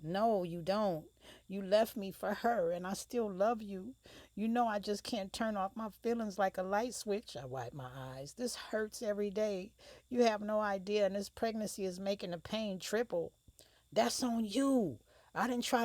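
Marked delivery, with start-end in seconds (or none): 0.0-7.4 higher, louder, voice straining with hurt; upset and hurt. Acted. Hurt ex pleading, crying. 8.3-13.3 higher, louder, voice straining with hurt; upset and hurt. Acted. Wiping eyes, pleading.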